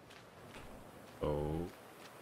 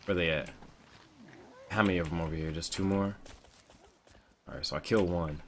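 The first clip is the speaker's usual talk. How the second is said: ordinary talk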